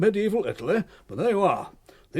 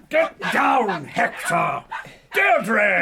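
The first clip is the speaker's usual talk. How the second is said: loud commands to jumping dogs; shouting at dogs; 3 semitones higher, 4 dB louder, much slower than usual. Acted. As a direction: bark orders at the dogs.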